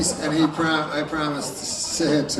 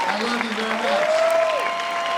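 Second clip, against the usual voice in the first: falsetto sign-off; slower than usual. Acted. Comic sign-off.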